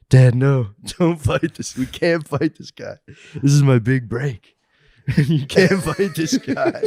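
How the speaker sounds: loud, high mock plea, breaking into laughter; retold pleading. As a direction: beg dad to stop, half laughing. Retold pleading with his fighting dad.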